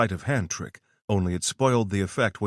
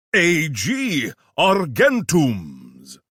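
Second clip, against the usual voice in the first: pompous British; 7 semitones higher, 6 dB louder, much slower than usual. Acted. Professor pompously spells it out.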